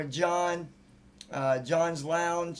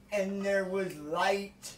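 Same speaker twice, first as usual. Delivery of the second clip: solemn Bible narration; 4 semitones higher, much slower than usual. Pretend.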